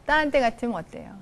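usual speaking voice

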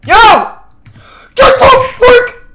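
loud, high, stretched-out shout; startled yell. Real, not acted. Streamer fending off a sudden game attacker, startled high shout. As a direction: yell them away in alarm.

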